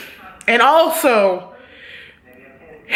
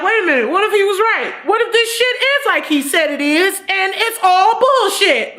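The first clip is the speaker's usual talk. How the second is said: sudden realization; 8 semitones higher than usual. Retold or imitated. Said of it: "And she like".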